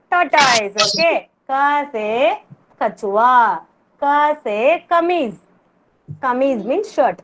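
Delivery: ordinary talk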